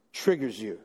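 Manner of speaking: ordinary talk, nothing marked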